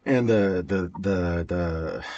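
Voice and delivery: repeating the first word, stumbling; stuttering. Real, not acted. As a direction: catch on "the" over and over. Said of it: "I don't know why I'm stuttering so much today".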